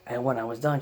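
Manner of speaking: usual speaking voice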